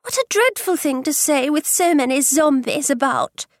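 put-on a fretful young girl's voice. A narrator's reading. Kitty protesting to her mother, fretful and high. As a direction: worry at your mother, lightly voiced girl. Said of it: "replied Kitty fretfully".

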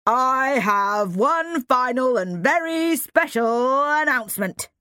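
slow, loud headmaster voice; headmaster announcing. Acted. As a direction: announce it grandly to the hall.

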